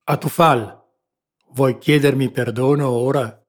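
lightly troubled master pressing his servant; slightly agitated, calling out the question. A narrator's reading. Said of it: "disse con voce turbata".

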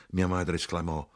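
plain reading voice

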